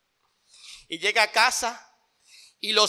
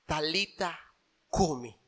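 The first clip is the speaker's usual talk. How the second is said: soft Aramaic; 3 semitones lower, 6 dB quieter, slower than usual. Acted. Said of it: "palabras en arameo".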